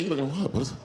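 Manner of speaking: normal talking voice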